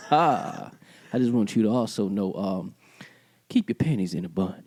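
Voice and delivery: ordinary talk, nothing marked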